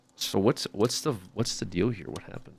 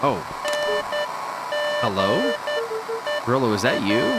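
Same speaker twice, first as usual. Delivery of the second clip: calling out. Acted. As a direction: call out to an empty room, unsure. Calling out into empty house.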